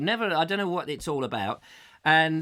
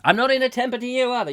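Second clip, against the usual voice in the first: mock shout at the kids; 7 semitones higher, 5 dB louder, faster than usual. Scolding his off-screen children.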